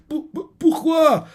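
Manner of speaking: high, slow stammer; stammering bewilderment. Acted. Fallen classmate asking why, high stammering disbelief. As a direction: stutter in disbelief.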